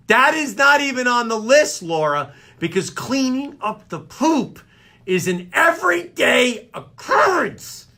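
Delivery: high, exasperated rant; indignant. Acted. Host argues about his to-do list, indignant.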